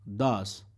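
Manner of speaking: "does" said as das, like Spanish dos; "does" rhymes with dos, short a vowel. Shown as a demonstration. Teacher shows students "does" said like dos. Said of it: "imagínese que es, eh, como el número dos".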